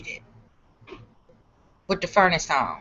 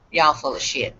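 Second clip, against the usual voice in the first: scoffing dismissal; 7 semitones lower, 3 dB quieter than usual. Retold or imitated. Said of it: "I was like".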